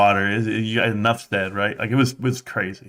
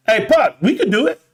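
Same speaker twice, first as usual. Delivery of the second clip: put-on high, louder boyish eagerness; eager kid; 11 semitones higher, 4 dB louder than usual. Boys to their dad, high and eager. Eager fifth graders volunteering.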